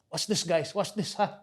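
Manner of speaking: put-on hushed Filipino-accented uncle. Uncle tipping off kids in the car, Filipino accent. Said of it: "In his funny little Filipino accent".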